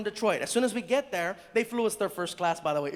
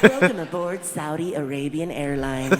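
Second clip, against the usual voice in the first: mock flight announcement; 4 semitones lower, 6 dB louder than usual. Plane announcement after fee paid.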